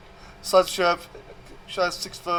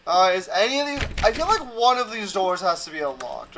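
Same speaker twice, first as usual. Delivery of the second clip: normal talking voice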